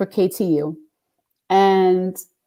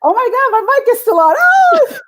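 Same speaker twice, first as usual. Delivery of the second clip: very high, fast mock shriek; horrified realization; 5 dB louder than usual. DJ realizing her mic is still on, mock-horrified shriek. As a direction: replay the panic at full pitch, rising to a scream. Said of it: "and then it hits me".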